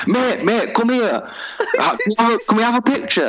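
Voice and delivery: high, laughing mate calling; mock pushy guest. Imitating a guest tapping him for a photo, high and laughing.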